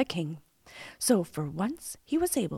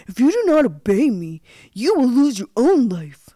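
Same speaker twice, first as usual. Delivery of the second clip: loud, raised villain's threat; domineering storybook villain; threatening old cook; 6 semitones higher, 10 dB louder than usual. A narrator's reading. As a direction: lay down a menacing order, loudly.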